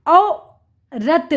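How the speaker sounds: औ, pause, then रत, slowly; sounding out. Shown as a demonstration. Teacher reading to children, syllable by syllable. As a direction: split it slowly: औ... रत. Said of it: "उच्चारण करके पढ़ लिया".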